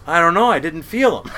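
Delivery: put-on high, loud kid punchline; voiced little son. Son answering dad on speakerphone, imitated high and loud. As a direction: imitate your kid answering in all innocence. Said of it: "And Charlie goes".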